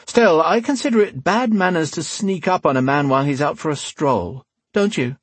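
dryly offended gentleman rogue; cool rogue, mock-polite. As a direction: chide the captive with elegant menace.

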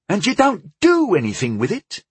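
sudden high outburst; bursting out. A narrator's reading. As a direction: blurt it out in disbelief. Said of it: "Tyndall burst out".

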